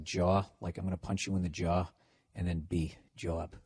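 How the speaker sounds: "job" built as "jaw" plus a B; Boston "job". Shown as a demonstration. Host teaching listeners Boston accents, "job" said as "jaw-b". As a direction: open "job" into a broad "jaw", then close on B. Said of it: "just say, like".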